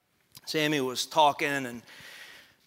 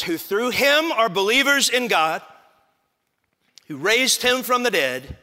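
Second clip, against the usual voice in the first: louder, higher preaching read; emphatic scripture reading; 8 semitones higher, 9 dB louder than usual. Acted. Preacher reads Peter's letter to the congregation, emphatic. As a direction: proclaim the verse with force.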